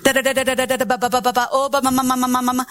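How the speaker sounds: da da da, ma ma ma, rhythmic babble; babbled syllables. Shown as a demonstration. Preacher shows the congregation how tongues can start, steady babble. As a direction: repeat simple baby syllables in a steady run. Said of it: "a lot of people start tongues like that".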